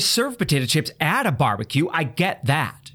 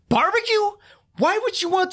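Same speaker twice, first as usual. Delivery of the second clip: incredulous; 13 semitones higher than usual. Acted. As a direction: sound like you can't believe "Barbecue?" is a real flavor. Ranting, disbelief at flavor.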